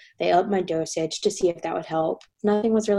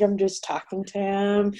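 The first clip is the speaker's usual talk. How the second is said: normal talking voice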